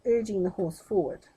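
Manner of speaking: usual speaking voice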